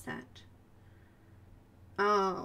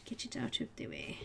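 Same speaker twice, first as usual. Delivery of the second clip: whispered to self; 13 semitones lower, 10 dB quieter than usual. Real, not acted. Artist whispering painting steps.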